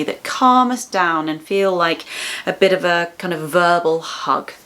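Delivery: ordinary talk, nothing marked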